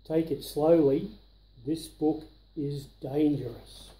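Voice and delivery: ordinary delivery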